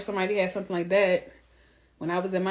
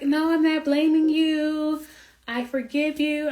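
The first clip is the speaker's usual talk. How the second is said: mock meek wife; 7 semitones higher, 4 dB louder, slower than usual. Mocking the wife on the reunion, meek. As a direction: sound timid and forgiving. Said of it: "And she's like".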